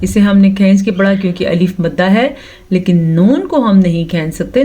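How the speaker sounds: normal talking voice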